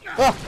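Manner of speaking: short, high, loud "ah"; sudden cry of surprise; startled yelp. Real, not acted. Player startled while sneaking up, sharp yelp.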